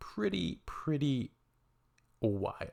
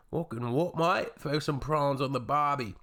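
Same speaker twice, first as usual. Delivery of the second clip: hammed-up Aussie accent, pitched up; Australian accent; 5 semitones higher than usual. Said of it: "We got an Australian".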